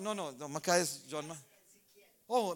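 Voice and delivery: usual speaking voice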